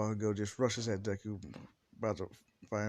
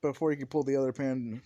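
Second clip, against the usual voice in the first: ordinary delivery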